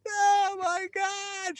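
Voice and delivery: high, slow mock scream; imitating a woman sobbing "Oh my God" in a high voice. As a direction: wail it out like an overwhelmed fan. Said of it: "this woman screaming".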